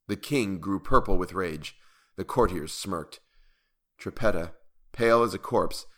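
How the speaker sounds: ordinary delivery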